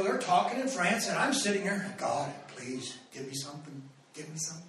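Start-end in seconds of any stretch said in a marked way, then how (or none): 2.0-4.6 quiet prayer. Retold or imitated. Silent desperate prayer in meeting.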